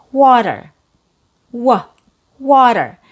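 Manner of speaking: "water" with a stressed open "aw" and a quick d; strong "wa", then a light flapped "der" in "water"; fast D. Shown as a demonstration. Teacher breaking down American "water" for learners, fast D. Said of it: "has a fast D sound".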